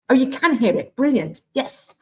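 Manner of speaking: real surprise that the sound works; happy gasp of discovery; delighted surprise. Real, not acted. Realises the class can hear audio.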